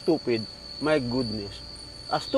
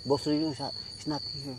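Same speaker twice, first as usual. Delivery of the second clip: mic handed over; 7 semitones lower, 12 dB quieter, faster than usual. Real, not acted. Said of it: "you're so loud".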